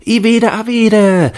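hoher, lauter, gedehnter Kinderruf; schreiende Kinder. A narrator's reading. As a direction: als Kinder laut rufen. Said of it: "schrien einige". (hoch und laut rufen)

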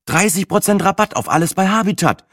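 high, thrilled voice over a bargain; light up at the discount; excited discovery. A narrator's reading. Friend reading out a sale, excited.

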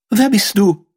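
high-pitched princess question; startled princess. A narrator's reading. Princess confronts the strange young man, high startled question. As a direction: demand it in a high voice.

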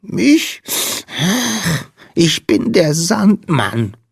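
high little voice, slow and woeful; sniffling squeak. A narrator's reading. Tiny Sandman introducing himself to the girl, sniffling. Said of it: "schniefte der Wicht".

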